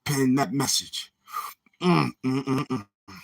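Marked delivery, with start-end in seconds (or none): none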